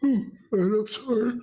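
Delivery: words stretched through a real yawn. Real, not acted. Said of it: "I'm yawning".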